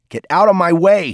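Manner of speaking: loud, high shouted order; shouting. A narrator's reading. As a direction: shout it as a threat. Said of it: "he shouted".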